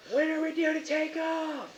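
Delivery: high, drawn-out yell over a helicopter. Acted. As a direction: holler it slowly at high pitch. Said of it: "and you're all yelling".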